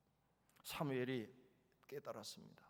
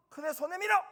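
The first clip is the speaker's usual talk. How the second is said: put-on loud high order to the child; stern command; 11 semitones higher, 12 dB louder than usual. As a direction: bark it like an angry parent. (큰애에게 손 내밀라고 크게 호통치는 부모)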